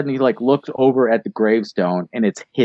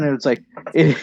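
ordinary delivery

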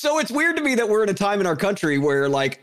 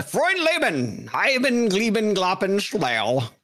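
mock German gibberish; slower than usual.

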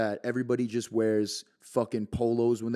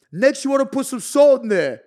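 louder, higher cooking-video voice, hammed up; mock loud cooking host; 12 semitones higher, 10 dB louder than usual. Creator quoting his own too-loud video line, hammed-up loud. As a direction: belt it like a cooking show host. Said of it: "if I say something a little too loud".

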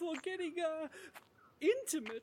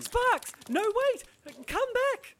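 loud, higher shout after the dog; calling out; 7 semitones higher, 8 dB louder than usual. Acted. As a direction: shout after him as he runs. Dog runs away.

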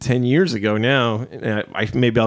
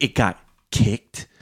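disgusted; slower than usual. Retold or imitated. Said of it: "she was so disgusted".

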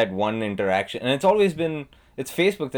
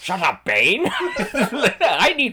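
mock Joker voice; hammy cackling villain; 11 semitones higher than usual. Continues Joker bit.

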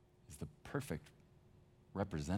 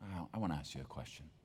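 softer, gentle and unhurried; tender question; 7 dB quieter than usual. Acted. Jesus asking Peter over breakfast, soft and tender. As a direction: ask it softly, like forgiving a friend. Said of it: "He says".

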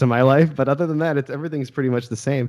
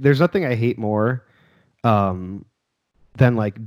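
usual speaking voice

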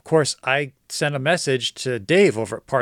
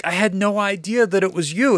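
amazed exclaim; 8 semitones higher, 4 dB louder than usual. Retold or imitated. Surprised meeting familiar seller.

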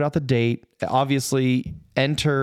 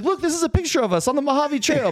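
pleading. Retold or imitated. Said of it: "I'm like".

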